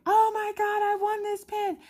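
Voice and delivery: excited squeal. Retold or imitated. Wife to husband, squealing high over winning a pin. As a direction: squeal like you just won the prize. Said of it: "I got all excited".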